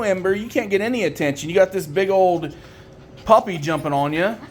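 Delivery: ordinary talk, nothing marked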